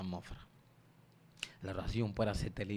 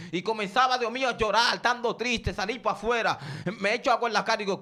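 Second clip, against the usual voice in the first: loud, high, impassioned preacher's cry; fiery Pentecostal sermon delivery; full-force preaching; 9 semitones higher, 9 dB louder than usual. Acted. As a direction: preach it hard to the congregation.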